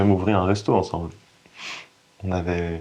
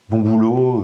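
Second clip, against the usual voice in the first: louder voiced praise, acting out approval; imagined praise; 3 semitones higher, 7 dB louder, slower than usual. Cook voicing the praise he wanted from his brother, imagined praise. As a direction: call it out as the praise you longed for.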